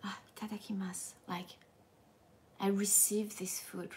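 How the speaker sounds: soft unvoiced whisper; hushed whisper. Shown as a demonstration. Teacher shows how Japanese people quietly say it. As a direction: murmur it humbly under the breath. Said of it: "people are more like".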